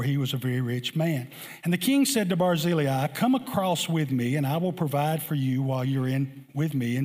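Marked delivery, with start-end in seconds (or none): none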